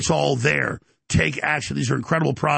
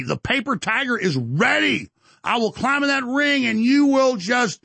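rising fired-up challenge; 7 semitones higher than usual. Acted. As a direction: hype up the fight challenge. Ramped-up challenge to Tim Pool.

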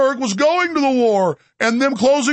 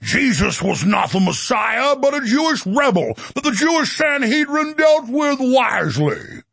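officious preacher impression; 3 semitones higher than usual. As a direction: parody a pompous seminary-trained preacher. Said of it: "That's how he talks in his voice".

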